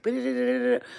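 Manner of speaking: high-pitched babbled "ba-da-da" imitating nonstop talkers; mimicked chatter. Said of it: "they're just like".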